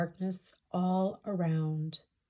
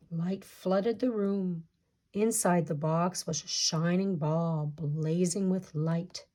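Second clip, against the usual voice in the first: ordinary delivery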